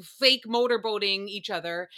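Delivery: normal talking voice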